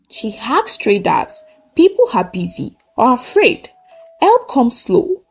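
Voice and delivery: usual speaking voice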